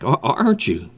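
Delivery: normal talking voice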